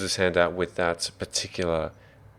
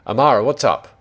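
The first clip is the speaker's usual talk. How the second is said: raised, higher and louder call; Thorum; 6 semitones higher, 9 dB louder, faster than usual. Acted. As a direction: call out loudly to a companion. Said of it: "Thorum says".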